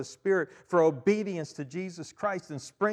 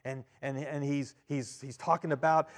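ordinary talk

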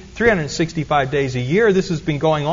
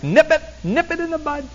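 much higher, excitable deputy voice, a little softer; playful quote of a TV deputy, pitched way up; Barney Fife impression; 9 semitones higher, 4 dB quieter than usual. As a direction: jump up into a jittery small-town deputy and repeat it twice. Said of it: "Barney Fife's".

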